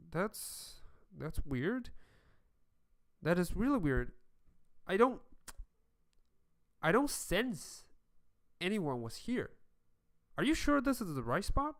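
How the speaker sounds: puzzled disbelief. Acted. Game master as Charming to the party at the empty spot, puzzled. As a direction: question it haltingly, pitch lifting.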